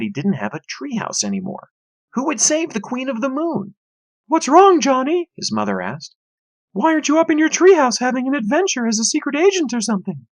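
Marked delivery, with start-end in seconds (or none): 4.3-5.3 high, loud motherly voice; concerned mother. A narrator's reading. Mother checking on her moping son, high voice. 6.7-10.3 chipper mother. A narrator's reading. Mother asks son.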